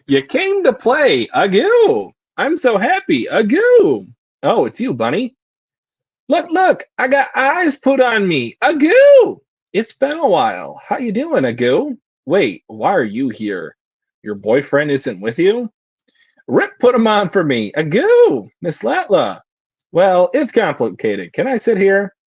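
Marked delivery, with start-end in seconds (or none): 0.1-12.0 high-pitched, gleeful squeal of a playful little character; excitable cutesy character. Acted. A playful manga character greeting Bunny, high and excitable. 16.5-22.1 high-pitched, gleeful squeal of a playful little character; excitable cutesy character. Acted. A playful manga character greeting Bunny, high and excitable.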